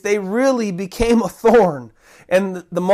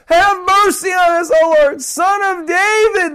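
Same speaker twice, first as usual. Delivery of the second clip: yelling for mercy; 9 semitones higher, 3 dB louder than usual. Acted. Blind men yelling to Jesus over the multitude, crying out. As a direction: cry out over a loud crowd. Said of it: "yelling as loud as they could".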